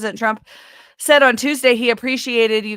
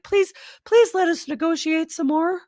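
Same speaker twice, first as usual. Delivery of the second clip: much higher, whiny pleading; a hammed-up plea from the losing side; mock begging; 11 semitones higher than usual. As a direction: pretend to grovel for more talks. Mocking Europeans crawling back.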